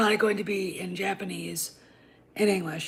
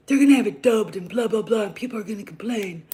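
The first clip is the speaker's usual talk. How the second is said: mildly mocking complainers, louder at the start; mock whining; 7 dB louder than usual. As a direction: imitate people whining, emphatic then tailing off. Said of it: "whining about".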